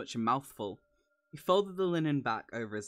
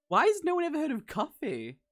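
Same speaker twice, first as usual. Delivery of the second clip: much higher, louder incredulous complaint; mock exasperation; 7 dB louder than usual. Real, not acted. Reader to friend about the story, high loud incredulous complaint. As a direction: throw it up in comic disbelief.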